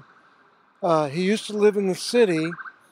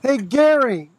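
calling out across the creek; calling from far away; 5 semitones higher, 4 dB louder than usual. Real, not acted. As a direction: shout his name into the distance. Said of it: "Is that the best you can yell?".

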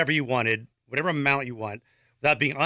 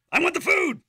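much higher, squeaky quoted line; high squeaky reading; 13 semitones higher than usual. Retold or imitated. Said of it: "Angela says".

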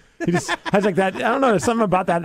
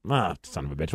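much lower and softer, under the breath; muttered curse; 11 semitones lower, 8 dB quieter than usual. Real, not acted. Host fumbling the song playback, muttering in annoyance. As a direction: grumble it to yourself.

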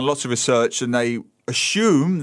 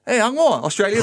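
higher-pitched, questioning imitation of a stranger; surprised questioner; 8 semitones higher than usual. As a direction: mimic someone suddenly asking about the accent. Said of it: "I get asked".

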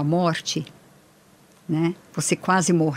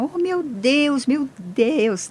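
retold tearful cry; 7 semitones higher, much slower than usual. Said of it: "aí eu dei um berro, comecei a chorar".

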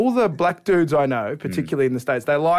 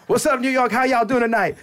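comedian; 6 semitones higher than usual. Retold or imitated. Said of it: "the comedian goes".

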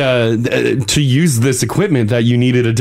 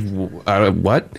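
low quiet baffled voice; confused mumble; 5 semitones lower, 4 dB quieter, much slower than usual. Retold or imitated. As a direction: mumble it, totally lost. Jamie oblivious, confused reply.

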